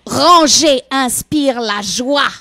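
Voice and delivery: slow loud grand proclamation; dramatic announcement. Acted. Dramatic title reveal.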